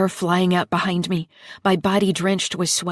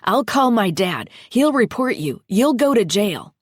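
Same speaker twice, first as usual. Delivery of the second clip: put-on boy threatening loudly; 4 semitones higher, 6 dB louder than usual. A narrator's reading.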